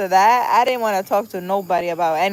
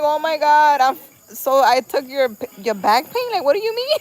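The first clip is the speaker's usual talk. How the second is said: loud, higher reenacted alarm; retold panic, rising to a high disbelieving question; panicking; 7 semitones higher, 6 dB louder than usual. Speaker retelling her call with cousin, alarmed. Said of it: "I was panicking".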